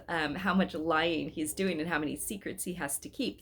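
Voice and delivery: ordinary delivery, nothing marked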